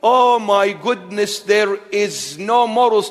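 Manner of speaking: ordinary talk, nothing marked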